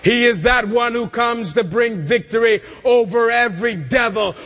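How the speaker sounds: normal talking voice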